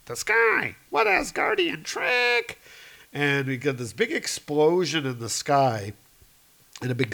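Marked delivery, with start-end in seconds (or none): 0.1-2.6 trolls shrieking. Acted. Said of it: "the trolls are like".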